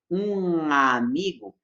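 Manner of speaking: slow um amigo with a nasal ng joining the words; nasal linking. Shown as a demonstration. Teacher showing Portuguese nasal link, slow pron. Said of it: "eu posso tentar fazer o mesmo som".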